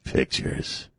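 hushed breathy creep; creepy voice. Pretend. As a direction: leer the word like a creep. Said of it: "This is my creepy voice".

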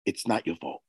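soft, low and close. Retold or imitated. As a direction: hush it, leaning right in. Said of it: "I get a little closer".

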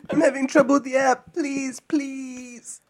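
pleading. Pretend. Mocking a pleading plea to the scammer.

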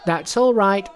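high, loud, cocky Monkey voice; high-pitched, cheeky monkey king; unbothered Monkey. A narrator's reading. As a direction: pitch it right up, untroubled.

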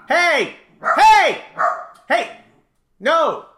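loud shouting at the barking dogs; real shout at the dogs. Real, not acted.

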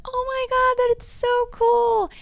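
very high, slow, thrilled squeal; delighted gush. Retold or imitated. Hearing the kids pick her as mom, high thrilled gushing. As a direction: gush like the best compliment ever. Retold delight at kids' praise.

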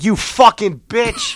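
drawn-out insult. Shown as a demonstration. Passing the pulled-over driver, a slow jeering insult. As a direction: stretch each word out at her. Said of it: "Like that".

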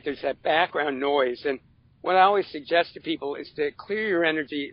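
ordinary talk, nothing marked

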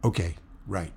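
soft, low acknowledgement; imitating a subdued, quiet reply. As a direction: answer barely above a murmur. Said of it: "they said very quietly".

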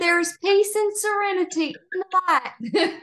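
high-pitched put-on medium's voice; movie character impression. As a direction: quote the film in a high, eerie sing-song. Quoting a scary movie character.

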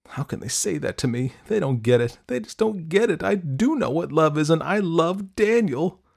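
put-on high, upset teenage outburst; tearful protest. A narrator's reading. Daughter fleeing her parents in tears, high and upset. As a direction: wail the protest at high pitch. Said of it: "ran from the room in tears".

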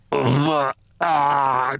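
choked gasps like a drowning man; choking gurgle. A narrator's reading. Briggs drowning in camphor fumes, choking gasps. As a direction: gurgle and gasp for breath. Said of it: "choked Briggs like a drowning man".

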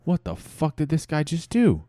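aghast. Acted. The whole room after Chad bites the bag, aghast. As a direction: react in appalled amazement. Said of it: "it left the whole room aghast".

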